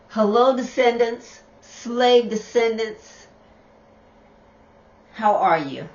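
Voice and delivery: clear, firmer greeting to viewers; speaking up. Real, not acted. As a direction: project the greeting so everyone hears. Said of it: "I'm gonna say it again".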